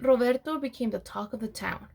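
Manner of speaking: usual speaking voice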